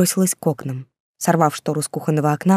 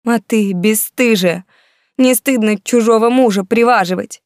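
angry attack; 4 semitones higher, 4 dB louder than usual. A narrator's reading. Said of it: "Светка накинулась теперь на меня".

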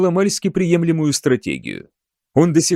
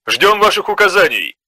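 higher, urgent soldier report; urgent sergeant report; 4 semitones higher, 3 dB louder than usual. A narrator's reading. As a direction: report the danger fast and tense. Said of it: "доложил сержант".

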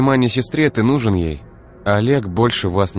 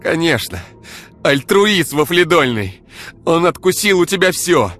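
high, loud, screaming tirade; enraged woman screaming her grievances at her boyfriend; furious rant; 12 semitones higher, 6 dB louder than usual. Acted. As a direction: hurl every grievance at him. Ira furious rant at Igor.

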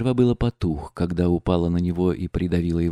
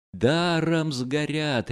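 slow, pitched-up crowd roar on Даром; 7 semitones higher, much slower than usual. A narrator's reading. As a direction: everyone bellows as the money burns, pitched-up roar. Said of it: "ревели со всех сторон".

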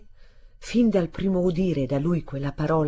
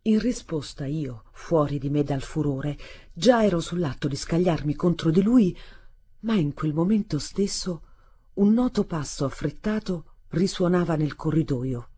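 plain reading voice

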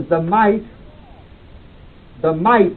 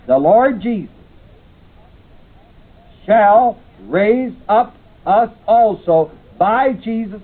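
scripture reading; 4 semitones higher than usual. Acted. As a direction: read the Bible passage out with weight. Reads scripture aloud in class.

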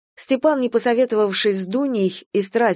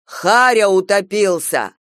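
slow, louder bark; slow, louder barked alarm; 5 dB louder, slower than usual. Acted. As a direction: bark it out over the seat. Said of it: "рявкнул".

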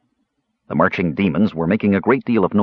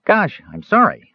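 high, light kender apology; a sheepish kender, pitched up; 10 semitones higher than usual. A narrator's reading. Kender Earwig apologizing to the creature, high and light.

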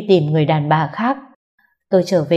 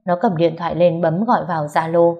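usual speaking voice

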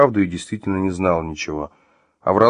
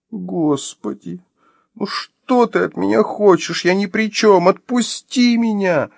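high, drawn-out wailing plea; desperate pleading; 12 semitones higher, slower than usual. Acted. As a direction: wail it slowly, begging for release. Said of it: "Не обратив внимания на её мольбы".

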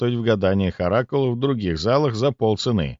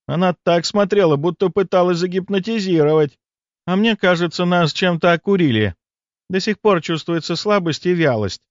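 complaining; 9 semitones higher, 3 dB louder than usual. A narrator's reading. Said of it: "пожаловалась Аурика".

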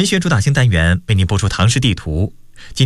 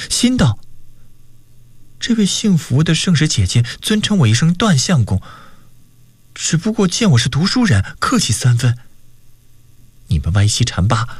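animated storyteller with acted dialogue; story narration; 4 semitones higher than usual. A narrator's reading. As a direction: narrate the tale with wide swings in pitch. Narrates a novel chapter. (绘声绘色地讲小说)

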